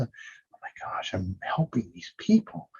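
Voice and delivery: whispered, slow, amazed; whispering in awe while picturing a cheering crowd; awed whisper. Shown as a demonstration. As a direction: breathe it out in quiet wonder. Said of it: "feel the".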